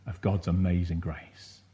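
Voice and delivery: ordinary talk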